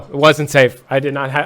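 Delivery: usual speaking voice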